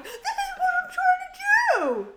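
high, loud exasperated shout; mock angry yell. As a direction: yell it like a frustrated partner. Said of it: "So you're like".

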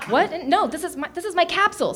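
a touch of flustered alarm; confused protest. A narrator's reading. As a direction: object in mild disbelief. Tony objects, confused.

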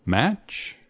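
ordinary talk

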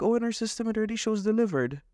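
high, sing-song mock of an unhelpful agent; prissy high voice, mimicking her. Mimicking the unhelpful phone agent in a high, prissy voice. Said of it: "She's like".

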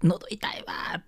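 high-pitched impression of an excitable auntie; boisterous Kansai auntie. Auntie complaining of a sore throat to her friends, high-pitched mock moan. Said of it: "爆発しがちなおばちゃん集団".

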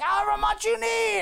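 high, hollered offer; blustering boss. Acted. Boss asking Girardi his price, hollering. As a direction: ham up a big-spending boss.

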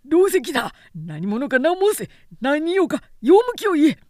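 high, loud frantic demand; shrieking guard; panicked yell. Acted. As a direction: yell in rising alarm. Guard yells at attackers.

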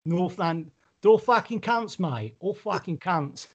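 hammed-up Cockney grumble; London accent. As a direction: mouth off like a mock London geezer. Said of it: "That's London".

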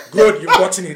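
loud, emphatic imitation of dad; dad's way of talking. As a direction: proclaim it like a father catching you out. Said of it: "that's how he talks".

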